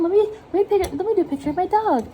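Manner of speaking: high-pitched self-quote. Retold or imitated. Artist voicing herself starting a dog picture, high and lilting. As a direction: voice your own little drawing plan up high. Voices herself setting out to draw.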